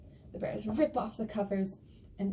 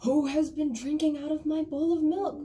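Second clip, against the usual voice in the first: loud, high, outraged bear voice; big bear; 6 semitones higher, 7 dB louder than usual. Acted. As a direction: demand it like an affronted storybook bear. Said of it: "and they say".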